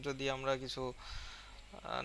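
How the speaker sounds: ordinary delivery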